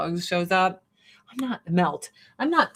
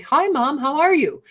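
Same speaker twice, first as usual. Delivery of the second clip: friendly cat voice; 6 semitones higher, 3 dB louder than usual. Retold or imitated. Voices the friendly Maine Coon.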